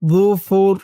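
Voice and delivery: "যুফুর" opening on a soft, heavy dh; gentle voiced th starting "যুফুর"; very soft initial consonant. Shown as a demonstration. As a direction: say "যুফুর" as dhufur, tongue softly at the teeth. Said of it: "উচ্চারণটা একদম নরম হবে".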